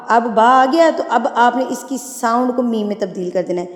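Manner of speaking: ordinary talk, nothing marked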